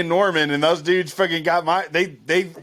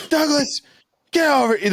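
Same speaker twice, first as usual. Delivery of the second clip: high barking mock cop; cop calling; 8 semitones higher than usual. Cop calling Douglas back at the party, mock shout. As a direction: bellow the name like a stern cop. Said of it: "they go".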